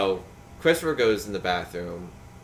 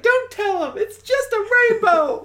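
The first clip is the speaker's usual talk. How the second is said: loud falsetto mother voice, drawn out; hammed-up, very high motherly shriek; mock protesting mom; 8 dB louder, slower than usual. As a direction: wail it as a mom in denial. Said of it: "'cause Mom's like".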